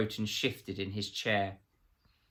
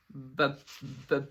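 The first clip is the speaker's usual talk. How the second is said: frightened stuck b-sound, a little higher; terrified stammer under a cold questioner; 5 semitones higher than usual. A narrator's reading. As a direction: stammer the first letter in fear. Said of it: "Absalom stammered".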